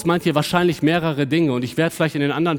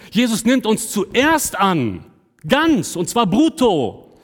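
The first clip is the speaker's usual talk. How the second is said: each word laid down slowly and heavily; slow emphatic preaching; 6 semitones higher, 3 dB louder, much slower than usual. Acted. Preacher stresses that Jesus accepts us wholly, slow and emphatic. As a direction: drive the point home word by word.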